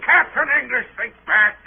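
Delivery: high, loud, pressing demand; insistent shout at the captain; urgent cry. Acted. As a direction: force him to remember. Heated confrontation with captain.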